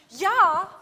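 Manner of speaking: kräftig und hoch herausgerufenes Ja; lautes Ja. Shown as a demonstration. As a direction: das Ja laut und hoch rausrufen. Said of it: "Sie machen alle nicht". (lautes, hohes „Ja“)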